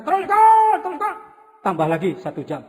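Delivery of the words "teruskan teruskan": eager audience. Retold or imitated. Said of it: "Kata mereka".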